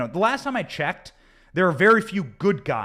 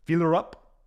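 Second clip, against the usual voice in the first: put-on a second try at the Russian accent, at normal pitch; an even-pitched Russian accent take, testing the sound. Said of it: "I gotta work on my Russian accent".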